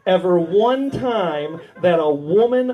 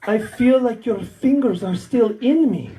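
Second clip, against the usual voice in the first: Russian stripper. Retold or imitated. Stripper telling her chiropractor how it feels, Russian-accented. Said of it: "I think, Russian stripper".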